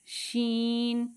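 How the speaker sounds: long hissed شین; drawn-out shin. Shown as a demonstration. Teacher reminding students of shin, drawn out. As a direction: stretch the sh of شین. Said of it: "پڑھ چکے ہیں".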